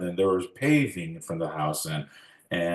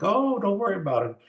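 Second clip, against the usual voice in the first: breezy reassurance; 13 semitones higher than usual. Retold or imitated. Parents reassuring the child.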